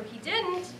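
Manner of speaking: a sharp high "He didn't?" of surprise; surprised. Retold or imitated. Mother answering her son's news, surprised. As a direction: catch the news and jump up in surprise.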